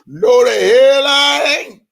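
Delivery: high, loud, drawn-out denial; mock outburst. Mocking a rival denying being CEO, loud and high. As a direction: belt out a mock refusal.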